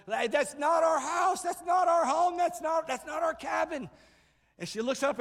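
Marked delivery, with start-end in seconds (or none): 0.1-3.9 alarmed yell from inside the cabin; retold yelling. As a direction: shout it across to her through the window. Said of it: "Somebody else starts yelling".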